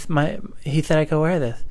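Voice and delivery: put-on whiny childlike objection; nursery school kid. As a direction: plead like a small child. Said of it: "it was my first day of nursery school".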